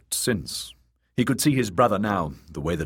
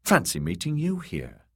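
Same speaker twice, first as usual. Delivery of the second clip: pleasantly; 9 semitones higher than usual. A narrator's reading. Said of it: "he'd said pleasantly".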